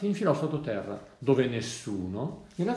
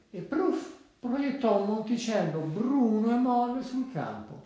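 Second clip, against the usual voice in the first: high, loud, slow narration; emphatic storybook splat; bursting sound effect; 7 semitones higher, 4 dB louder than usual. A narrator's reading. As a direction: launch it high and stretch it out. Narrator imitates pig's dropping shooting out.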